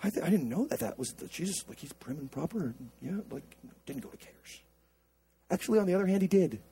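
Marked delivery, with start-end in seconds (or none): 0.0-4.6 small, very soft, lower voice; hesitant doubter. Acted. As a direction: timidly mimic an unsure listener, trailing away. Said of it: "Some of you are like".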